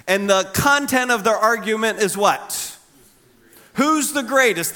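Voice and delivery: ordinary delivery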